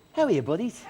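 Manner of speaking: a hint of a laid-back boyband singer saying hi. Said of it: "I particularly love [name]".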